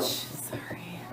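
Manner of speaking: whispered, breathy aside after a sneeze; whispered sorry. Real, not acted. Apologising to viewers after sneezing, a soft whisper. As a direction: murmur an apology under your breath.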